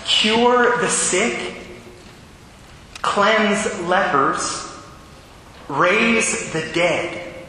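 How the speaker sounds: each phrase slowly, with weight; slow solemn proclamation; slow solemn commands. Acted.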